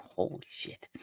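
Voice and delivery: breathy, whispered awe; whispered holy shit in shock; whispered disbelief. Retold or imitated. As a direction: hush it out, stunned. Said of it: "you're left going".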